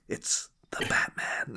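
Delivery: whispered, drawn-out Batman reveal; hushed film quote. Acted. Acting out a scared film character, whispered. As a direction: whisper it slowly, like a movie line.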